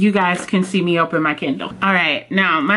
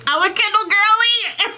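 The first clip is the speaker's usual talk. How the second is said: much higher, bubbling with glee; giddy delight; 13 semitones higher than usual. Real, not acted. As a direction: celebrate like a giddy fan. Unboxing, holds new Kindle.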